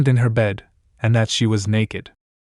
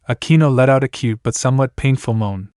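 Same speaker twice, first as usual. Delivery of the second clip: usual speaking voice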